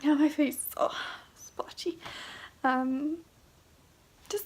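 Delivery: teary voice fading to a breathy hush; speaking through real tears; crying. Real, not acted. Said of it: "oh, tears".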